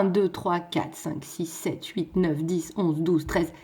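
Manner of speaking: low, soft running count; quiet counting. Real, not acted. Teacher counting a sonnet's lines for the class, quiet counting. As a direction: count off under your voice.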